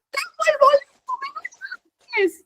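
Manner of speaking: crying high with happiness; tearful joy. Retold or imitated. Showing the pastor the ticket, crying with joy. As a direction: break into happy tears.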